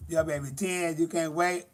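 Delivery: ordinary talk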